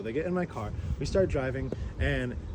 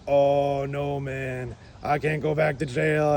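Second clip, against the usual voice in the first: drawn-out slacker lament; mock dismay, stretching the words; imitating worried passenger; much slower than usual. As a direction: groan it like a worried bro. Imitates Ricky panicking about jail.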